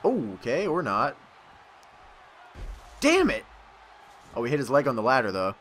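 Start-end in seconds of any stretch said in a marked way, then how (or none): 3.0-3.4 high, sudden curse; frustrated outburst. Real, not acted. Player cursing a missed move, frustrated. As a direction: burst out in frustration.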